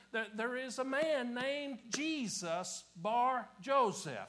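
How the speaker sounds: loud, high, driving sermon voice; full-force preaching. Acted. Preacher citing historical evidence to the congregation, full-force preaching. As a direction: preach it out, drawing out the key words.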